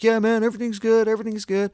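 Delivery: high-pitched, strained all-is-fine voice; insistent assurance. Retold or imitated. Dude answers the worried driver, high-pitched insistent assurance. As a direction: imitate a guy insisting too hard, pitch held high.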